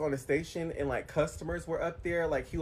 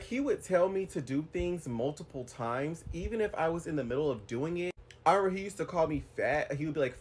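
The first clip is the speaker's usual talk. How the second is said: usual speaking voice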